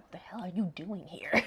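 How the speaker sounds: hissed, angry whisper; seething whisper. Retold or imitated. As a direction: whisper it through the rage. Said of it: "He's already seething".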